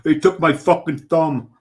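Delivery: hammed-up European accent through a laugh; movie-villain accent. Cohost riffs on the movie, laughing mock accent. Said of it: "Well, Frenchman".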